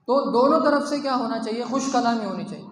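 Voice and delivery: ordinary talk, nothing marked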